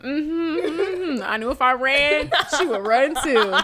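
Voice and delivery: high smug cat voice; lightly voiced smug cat; voicing the cat. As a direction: play the cat, pleased with itself. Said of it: "your cat was like".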